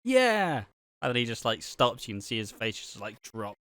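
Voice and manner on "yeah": put-on high, stammering kid voice; kid imitation. Imitating the kid in the video, high stammer.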